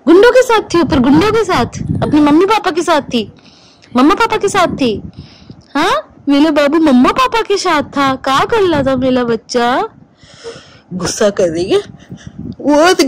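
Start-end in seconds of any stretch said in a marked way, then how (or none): 0.1-9.9 higher doting questions to the pet. Real, not acted. Owner asking her dog where she spent the day, doting baby talk.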